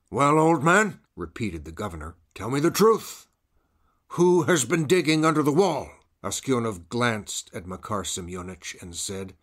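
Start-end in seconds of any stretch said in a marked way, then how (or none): 0.1-1.0 loud, high governor pressing the prisoner; stern demand. Acted. Governor to old prisoner, loud stern demand. 2.3-5.9 loud, high governor pressing the prisoner; stern demand. Acted. Governor to old prisoner, loud stern demand.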